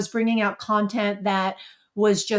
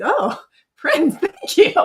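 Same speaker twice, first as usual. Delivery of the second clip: giggle, then a higher thank you; bashful laughing thanks to Prince; 8 semitones higher, 6 dB louder, slower than usual. Retold or imitated.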